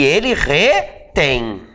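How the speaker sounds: slow "retém", syllables apart; word-by-word conjugation, slow; drawn-out dictation. Shown as a demonstration. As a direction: stretch "retém" into re, then tém. Writing out the conjugation.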